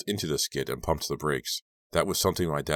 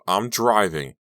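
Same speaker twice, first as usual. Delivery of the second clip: louder, higher yelled warning; yelling; 4 semitones higher, 6 dB louder, slower than usual. A narrator's reading. Driver warning Lucas reaching for her, yelled. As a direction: yell it as a warning. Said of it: "I yelled".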